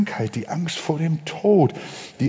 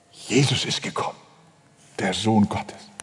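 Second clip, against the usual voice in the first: hushed reveal; 8 semitones lower, 5 dB quieter than usual. Acted. Hushed continuation.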